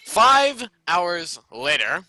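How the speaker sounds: slow, loud dramatic announcer; narrator voice. Pretend. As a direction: drawl it like a documentary narrator. Mock narrator time skip.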